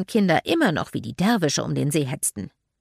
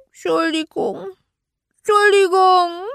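put-on high, wailing small-child voice, growing louder; toddler bawling; 12 semitones higher, 8 dB louder than usual. A narrator's reading. Toddler Archie upset about his toy train, bawling. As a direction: wail it like a little child. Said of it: "jetzt heulte Archie noch lauter".

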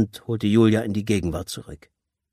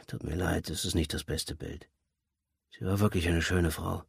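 soft apology; 2 semitones lower, 8 dB quieter than usual. Acted. Father apologizes, sensing disappointment.

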